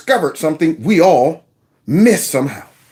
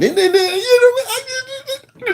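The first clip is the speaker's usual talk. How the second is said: high wavering fake sob imitating the man; mock crying falsetto; much slower than usual. Mocking the crying man, high fake sob. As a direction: squeak it out like a hammed-up crier. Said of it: "like my beautiful friend so-and-so".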